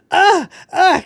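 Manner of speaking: high mock scream; two sharp high yelps like a rock singer. Mimicking song blast at sex scene.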